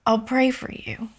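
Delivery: soft, near-whispered kindness; calm, sweet. Retold or imitated. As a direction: quote her gently, without emotion. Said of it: "She was so calm".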